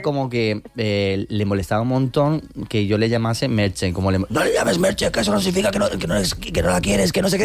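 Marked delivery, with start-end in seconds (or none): 4.3-7.4 higher, scolding grandpa voice; mock-scold like a stern Basque grandfather; grandfather's raised-pitch telling-off, lightly hammed; imitating grandfather. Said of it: "¿A quién estás imitando".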